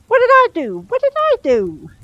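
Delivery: high squeaky mock-innocent protest, slowing; mock innocence. A narrator's reading. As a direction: play dumb in a shrill high voice.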